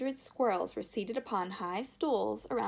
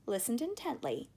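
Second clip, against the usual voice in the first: usual speaking voice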